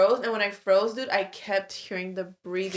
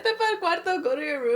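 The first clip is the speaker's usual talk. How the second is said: higher quote of grandma breaking into laughter; laughing at grandma's line; 7 semitones higher than usual. Retold or imitated. Retelling grandma's dismissal to friend, laughing. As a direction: repeat grandma's order, laughing. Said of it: "She said".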